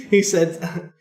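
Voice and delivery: usual speaking voice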